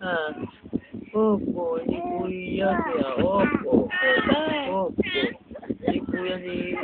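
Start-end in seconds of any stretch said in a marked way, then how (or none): 1.8-6.8 put-on baby voice. Voicing the baby's reply.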